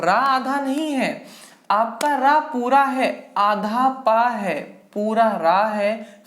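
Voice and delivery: drawn-out teacher emphasis on पूरा रा; slow emphatic teaching. Shown as a demonstration. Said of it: "teacher stresses key words".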